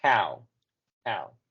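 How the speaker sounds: How said with an open A-like vowel, not O; vowel demo. Shown as a demonstration. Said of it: "vamos a tratar de pronunciarlo con el sonido A".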